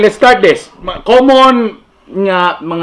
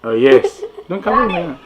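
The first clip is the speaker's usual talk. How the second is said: ordinary delivery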